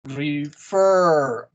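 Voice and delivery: "Refer" with a long, strong FER; stress on second syllable. Shown as a demonstration. Teacher shows second syllable stress, drawn-out "Refer" stressed on FER. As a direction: stretch and lean on the end of "Refer". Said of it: "La segunda sílaba está acentuada".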